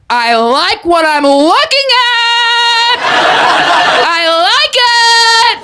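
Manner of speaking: high, long-held self-admiring cry; pride impression. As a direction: stretch it out high, hugely pleased with yourself. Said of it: "but really it's pride".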